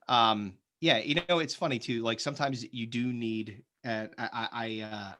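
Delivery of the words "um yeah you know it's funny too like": usual speaking voice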